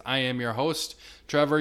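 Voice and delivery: normal talking voice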